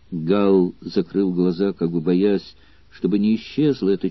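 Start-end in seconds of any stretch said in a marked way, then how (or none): none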